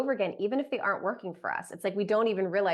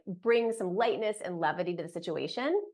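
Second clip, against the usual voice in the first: ordinary delivery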